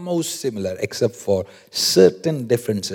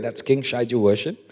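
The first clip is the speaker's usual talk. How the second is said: ordinary talk, nothing marked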